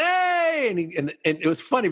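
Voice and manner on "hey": high, drawn-out hello; excited greeting. Retold or imitated. DJ greets the client's dad walking in, excited. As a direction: re-enact a big welcoming shout, pitched way up.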